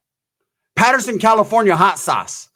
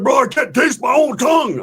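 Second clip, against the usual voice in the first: anguished shout; 8 semitones higher than usual. Retold or imitated. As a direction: wail about a numb tongue. Roach complaining, mouth burning.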